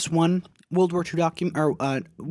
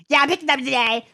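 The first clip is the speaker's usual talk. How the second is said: much higher, louder, fast mimic; mock girl voice; 12 semitones higher, 7 dB louder, faster than usual. Customer at the pharmacy counter, high, fast mock. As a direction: chirp it back like a mocked customer. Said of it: "She goes".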